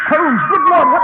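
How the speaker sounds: loud, high alarmed cry; jolted by a scream, shout out; startled shout. Acted.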